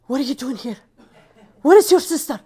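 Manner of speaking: a startled friend's voice, rising high and loud at the end; shocked hostess demanding answers; surprised woman. Retold or imitated.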